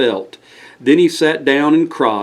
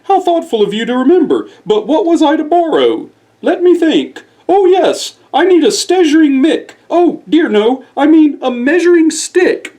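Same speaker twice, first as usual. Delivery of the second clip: put-on very high, dreamy old owl voice; a forgetful owl thinking aloud; Oscar Owl voice; 13 semitones higher, 3 dB louder than usual. Oscar Owl's voice.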